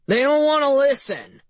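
high, loud exasperated complaint; yelled. A narrator's reading. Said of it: "yelled Bright".

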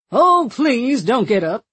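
put-on slow, polished mid-Atlantic accent; old newsreel mid-Atlantic drawl. A narrator's reading. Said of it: "in the sort of mid-Atlantic accent".

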